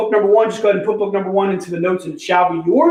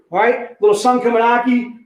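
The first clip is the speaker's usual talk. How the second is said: ordinary talk, nothing marked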